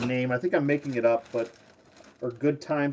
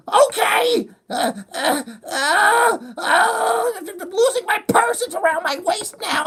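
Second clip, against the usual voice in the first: very high, loud, whimpering mock fluster; hammed-up near-crying fuss; the mother fumbling with her purse; frazzled mother; 5 dB louder, slower than usual. Mother keeps fussing in character.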